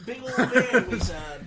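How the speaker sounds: laughing through the words. Real, not acted. Laughs describing the owl bear.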